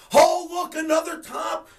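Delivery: loud, high sneering mimicry; mocking imitation. Host mocks chat-room top callers, loud sarcastic mimicry. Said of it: "you speak it in my chat room".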